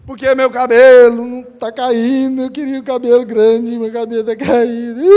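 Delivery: mock whining.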